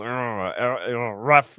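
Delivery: mock grumbling at the ref; imitated griping player. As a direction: imitate a player griping right in the ref's face. Imitates player griping in ref's face.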